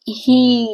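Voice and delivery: ही with a long drawn-out ee; long vowel demo. Shown as a demonstration. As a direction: hold the ee in ही for the class. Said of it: "देखो long बोल रहे हैं इसको".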